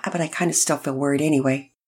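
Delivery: plain reading voice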